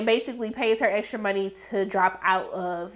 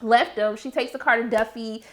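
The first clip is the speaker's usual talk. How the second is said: ordinary talk, nothing marked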